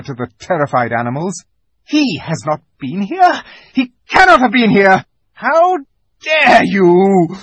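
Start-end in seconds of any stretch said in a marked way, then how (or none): 1.8-7.4 higher and louder, rising rage; outraged queen; furious witch. Acted. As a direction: deny it with mounting fury.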